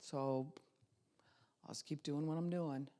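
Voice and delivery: resigned deadpan drone; flatline voice. Shown as a demonstration. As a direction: mumble it with no hope at all. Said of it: "you'll talk flatline".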